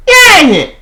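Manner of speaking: high squealed yay; sudden happy squeal; excited cheer. Real, not acted. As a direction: cheer it out delighted. Cheering at story moment.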